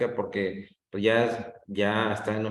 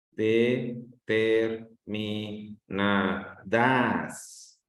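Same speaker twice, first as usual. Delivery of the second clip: "Determinadas" muy lento por sílabas, cargando el DAS final; acento en DAS; much slower than usual. Shown as a demonstration. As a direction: separa de-ter-mi-na-DAS, alargando DAS en "Determinadas". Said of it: "que la pongo en das". ("Determinadas" silabeado, con acento en DAS)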